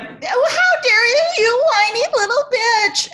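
high mock outrage; mock scolding. Imagined listeners scolding her, high mock outrage. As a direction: play the sneering listener, pitch up. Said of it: "I'm sure everybody is like".

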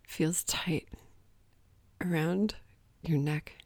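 soft, slow romance-novel read; romance narration. Acted. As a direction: read it breathy like a bodice-ripper. Mock romance novel reading.

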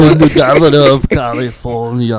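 loud, hammed-up Arnold boast; Arnold impression. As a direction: proclaim it like Arnold the governor. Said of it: "Let's try to do Arnold".

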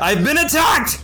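fake alarm. Disguised hero yells to deceive sailors, panicked. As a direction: sell the lie with a panicked yell.

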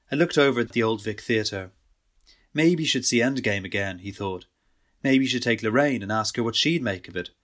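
ordinary delivery, nothing marked

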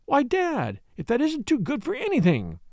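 high, bright-eyed cry of joy at seeing her father; glad cry. A narrator's reading. Daughter greeting her father by surprise, joyful cry. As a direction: daughter jumping up to greet her dad, delighted. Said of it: "started up with a cry".

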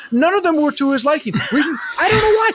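very high, incredulous ranting; exasperated rant. Acted. Host ranting about Doug's fuss over platters, pitch soaring in exasperation. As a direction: climb into a squealing, baffled tirade.

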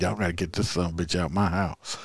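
quick, quiet, lightly voiced old man; mock grandfather quote. Host voicing grandfather's 911 call, quick and quiet. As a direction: rattle off the old man's words.

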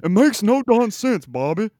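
high, slow, loud Texan drawl; a strained Hank Hill Texas accent, slowed down; Hank Hill impression. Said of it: "Yeah, I can't do it".

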